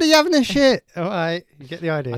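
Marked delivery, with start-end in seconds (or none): 0.0-0.8 calling out. Shown as a demonstration. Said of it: "I said".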